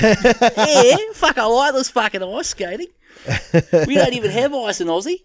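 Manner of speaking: high, loud bragging mock athlete; gleeful Aussie winner. Australian skater bragging after the fall, high and loud. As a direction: gloat like a lucky bloke. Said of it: "He's like".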